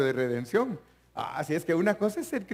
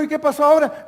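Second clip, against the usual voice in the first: mock questioning, much louder and higher; loud pressing question; 11 semitones higher, 10 dB louder, faster than usual. Pastor acting out a question to an ex-member, loud and pressing. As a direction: demand an answer from the drifting member. Pastor acts out asking a churchgoer.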